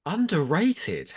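high, slow questioning disbelief; scoffing at the word underrated; incredulous. Real, not acted. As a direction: echo it back in disbelief. Incredulous echo of co-host.

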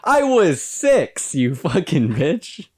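loud, slow, high mock outrage; indignant outburst. Real, not acted. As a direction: blurt it out playfully offended. Indignant comeback to teasing.

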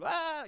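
high, soft mock wail; mock possessed wail. Preacher mimicking a possessed man, high mock wail. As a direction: imitate someone losing all faculties. Imitates someone losing all faculties.

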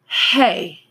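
put-on drunk, slurring the word Hey; thick-tongued tipsy hello; slurred and off after drinking. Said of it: "her voice sounded off, slurred".

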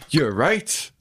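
normal talking voice

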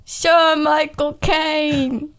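very slow, hammed-up British actor; Michael Caine. Guest naming the actor in his voice, slow impersonation. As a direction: stretch every word in a put-on English accent. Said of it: "I think it's Michael Caine".

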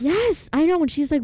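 loud, high burst of agreement; excited agreement. Real, not acted. Excitedly agreeing about the tense scene.

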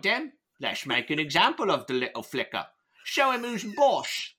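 high, hammed-up fake Cockney as Michael Caine; bad Michael Caine. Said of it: "my poor impersonation of Michael Caine".

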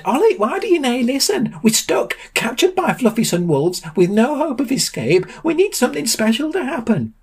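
high-pitched panicked pleading; desperate. A narrator's reading. Sam tells Ollie they are captured, desperate.

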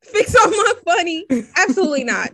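high, loud, appalled squeal; outraged high echo; mock outrage. Real, not acted. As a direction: shriek it back in disbelief.

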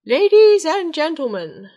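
booming herald call to the hall; royal announcement. Acted. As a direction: announce it loudly like a king. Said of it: "the king called the crowd".

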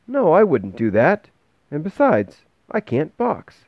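put-on very high child's voice; alarmed schoolboy objecting; boy Bastian, protesting. A narrator's reading. Boy character's held voice.

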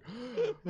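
high acted gasp; relieved gasp. Driver spared a ticket, acted gasp of relief. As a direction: gasp as if just let off the hook. Acts out body's relief at warning.

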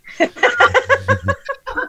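high eerie hag cackle; witch laugh. Shown as a demonstration. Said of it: "That's better".

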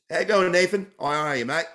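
put-on casual Australian accent saying hello. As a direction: play a friendly Australian mate. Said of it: "I got a pretty good accent on me".

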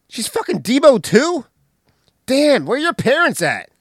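high, loud disbelief; shocked. Retold or imitated. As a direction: react in stunned disbelief. Smokey shocked.

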